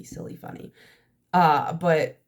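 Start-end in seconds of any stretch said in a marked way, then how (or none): 0.0-0.7 whispered, much softer silly, funny; whispered aside. Real, not acted. Admitting to viewers she is always goofy, whispered. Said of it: "I'm always goofy".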